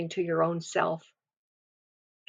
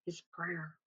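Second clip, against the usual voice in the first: much softer, almost breathed away; faded near-whisper; 18 dB quieter than usual. Shown as a demonstration. Preacher echoing his prayer to the congregation, fading to a whisper. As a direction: let the repeat fade to nothing. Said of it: "the Holy Spirit is emphasizing to us".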